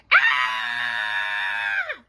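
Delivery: loud, sustained screech. A narrator's reading. Two girls alarming their babysitter, screeching. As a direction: screech wordlessly at full volume. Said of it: "they screeched".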